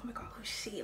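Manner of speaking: hushed mock gossip; imagined admirers. Imagined schoolmates noticing the new girl, mimicked in a hushed voice. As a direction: imitate onlookers murmuring, softly. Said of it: "people that come up like".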